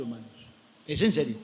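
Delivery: usual speaking voice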